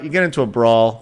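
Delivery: ordinary talk, nothing marked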